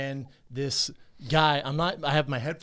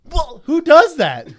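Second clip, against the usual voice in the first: much higher, louder, slow disbelief; incredulous outburst; 12 semitones higher, 12 dB louder, slower than usual. Real, not acted. Asking the cohost in disbelief about the biker, loud. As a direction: throw up your hands, who does that.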